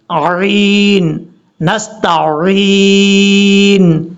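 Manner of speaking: drawn-out throaty 'عین', then 'نستعین'; clear ain. Shown as a demonstration. Teacher isolates the letter, slow correct recitation. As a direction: hold the ee of 'نستعین' very long. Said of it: "پڑھنے کا صحیح طریقہ".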